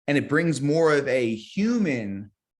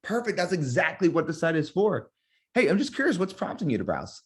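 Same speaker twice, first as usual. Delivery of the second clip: high bright "Perfect", then casual "Hey, I'm just curious"; friendly excited phone pitch; upbeat curious agent; 4 semitones higher than usual. Shown as a demonstration. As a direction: pitch it cheerful, then drop into a curious ask. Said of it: "It can sound something like this".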